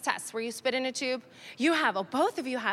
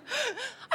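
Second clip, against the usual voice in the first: gasp; 12 semitones higher, 5 dB quieter than usual. Retold or imitated. Shocked by the daughter.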